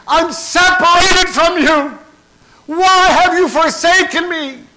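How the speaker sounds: very high, strained full-force shout; desolate cry. Acted. Jesus separated from his Father, loud desolate cry. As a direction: cry out in anguish at full volume. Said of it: "Jesus has this desolate cry".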